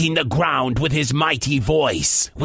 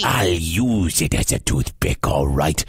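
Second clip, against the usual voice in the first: very low, scornful giant voice; snorting giant; 13 semitones lower than usual. Acted. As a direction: snort it deep like a huge brute. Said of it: "snorted the giant".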